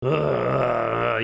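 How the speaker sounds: long, low groan of complaint; complainy tone. Shown as a demonstration. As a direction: groan as if fed up. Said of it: "kind of a complainy tone".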